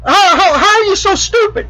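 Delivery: very high, incredulous mock glee; imitated hysterical amusement. Bet winner to the man who lost, acted hilarity. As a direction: squeal it as the tickled winner taunting the loser. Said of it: "laughing like a hyena".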